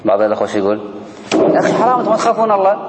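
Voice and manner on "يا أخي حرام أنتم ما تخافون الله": mock the zealot who scolds the whole gathering; harsh zealot rebuke. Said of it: "هذا متشدد".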